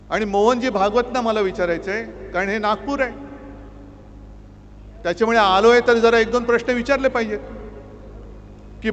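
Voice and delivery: loud, impassioned oratory to the crowd; fiery rally speech. Acted. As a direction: thunder it at a packed rally. Fiery rally attack on government.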